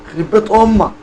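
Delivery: high, loud outburst of exasperation; exasperated curse. Real, not acted. Player pushed to try the food, loud exasperated curse.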